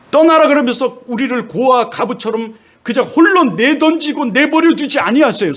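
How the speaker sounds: high, impassioned sermon voice; fervent preaching. Acted. Preacher urging the congregation, impassioned and high. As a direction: preach it, rising with conviction.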